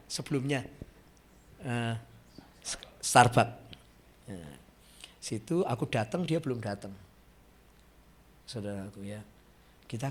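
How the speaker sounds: ordinary talk, nothing marked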